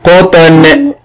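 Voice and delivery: "قو، قط، قطن" drawn out piece by piece; slow dictation. Shown as a demonstration. Teacher dictates words to a pupil, slow and deliberate. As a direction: dictate "قطن" unhurriedly, each sound held apart. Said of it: "والمعلم يمليه الكلمات بتمهل".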